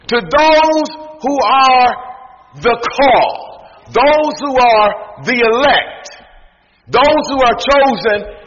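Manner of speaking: high sermon cadence with drawn-out phrases; full-force preaching. Real, not acted. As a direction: proclaim it phrase by phrase.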